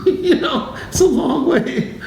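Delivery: can't hold back the giggle as you say it; laughing. Real, not acted. Telling the monk story, laughing high and breathy.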